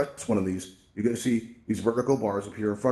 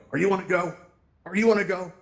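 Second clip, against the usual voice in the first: pitched-up mock confrontation; mock challenge; 8 semitones higher, 3 dB louder than usual. Avatars confront the newcomer who walked up, mock challenge. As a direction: play at picking a fight, voice raised in pitch.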